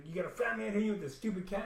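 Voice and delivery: fast sneering woman; mock scolding. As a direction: scoff at him in her voice. Woman mocking him again.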